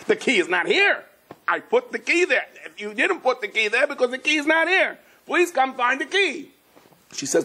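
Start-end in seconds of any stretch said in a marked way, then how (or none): 0.1-1.0 higher, word-by-word protest; exasperated insistence. Retold or imitated. Husband arguing with wife over the missing key, exasperated. 1.5-6.5 high-pitched, strained acted argument; exasperated quarrel. Couple bickering over a missing key, high exasperation.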